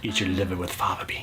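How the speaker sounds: menacing whispered movie quote; creepy whisper. Retold or imitated. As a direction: whisper it like a killer. Quoting a movie villain.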